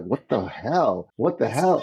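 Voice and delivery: a hint of a stunned friend; lightly voiced friend recoiling; shocked friend. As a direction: drag out the disbelief. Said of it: "And he's like".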